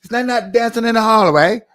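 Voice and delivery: loud, high mock British question. Shown as a demonstration. Host plays a Brit asking about dancing, mock British accent. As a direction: ask it in a hammed-up British accent. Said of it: "let me put on my British voice".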